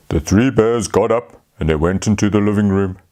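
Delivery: usual speaking voice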